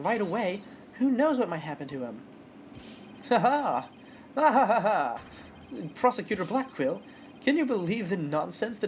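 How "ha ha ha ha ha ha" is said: loud, hammy "ha ha ha" laugh; hearty fake laugh. Detective laughing off the accusation, loud and hammy. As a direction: laugh it off like an overacted cop.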